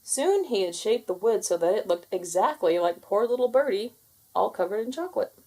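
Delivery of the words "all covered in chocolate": plain reading voice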